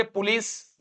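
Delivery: पुलिस with a 'pu' start; common mispronunciation. Retold or imitated. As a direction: mimic the everyday पुलिस, like 'pu-lees'. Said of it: "लोग या तो बोलेंगे". (पुलिस, 'पु' वाला गलत उच्चारण)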